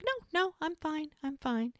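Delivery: high, softening reassurance; imitated dismissive "don't fuss"; brushing off concern. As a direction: wave the worry away in a high, light voice. Retold man brushing off concern.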